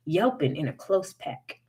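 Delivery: usual speaking voice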